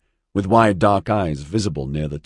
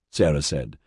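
ordinary delivery

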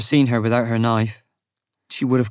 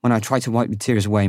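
ordinary delivery, nothing marked

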